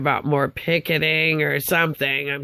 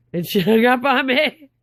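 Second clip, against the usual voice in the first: higher voice broken by laughter; incredulous laugh; 6 semitones higher, 3 dB louder than usual. Real, not acted. Telling of the second hang-up, incredulous laughing. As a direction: laugh in disbelief while saying it.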